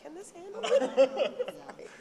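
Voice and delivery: high, slow, laughing mock worry; worried joke. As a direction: ask it half laughing, mock-nervous. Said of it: "I saw these chairs and I was like".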